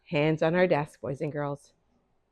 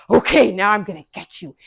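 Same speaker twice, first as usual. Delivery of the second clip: menacing Cain; 5 dB louder than usual. Acted. Cain voiced threatening his brother.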